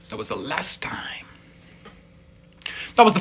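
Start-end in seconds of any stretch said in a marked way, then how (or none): 0.1-1.3 soft whispered regret; whispered aside. Real, not acted. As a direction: murmur it to yourself. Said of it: "rueful aside".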